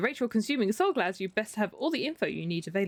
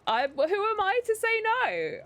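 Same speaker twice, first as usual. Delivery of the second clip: hammed-up mock innocence; 12 semitones higher, slower than usual. Hams up the character's line.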